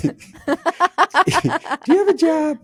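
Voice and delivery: very high, very slow squeaky teddy-bear voice, laughing through it; a tiny teddy bear asking, stretched out high and giggly; squeaky young voice. Pretend. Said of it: "a young voice".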